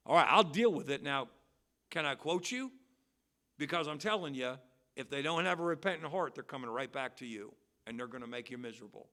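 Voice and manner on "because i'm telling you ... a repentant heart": ordinary delivery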